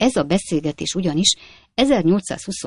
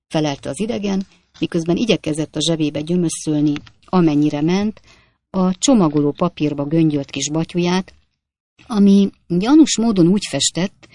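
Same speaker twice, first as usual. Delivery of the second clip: plain reading voice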